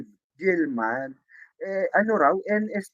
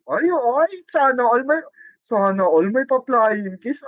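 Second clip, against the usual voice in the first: high, louder envious gush; 8 semitones higher, 4 dB louder than usual. Real, not acted. Vlogger envying the couple's flying kiss. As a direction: squeal with envy at the kiss.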